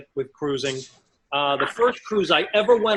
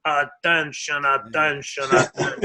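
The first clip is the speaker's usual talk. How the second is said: the real captain cutting in behind him; captain's PA voice, a bit lower; 4 semitones lower than usual. Retold or imitated. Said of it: "And I hear".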